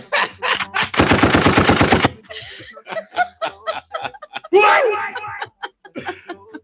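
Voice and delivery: long, loud, high shrieking laugh; hysterical laughing. Real, not acted. Guest cracking up mid-story, shrieking laughter. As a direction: crack up helplessly at full volume.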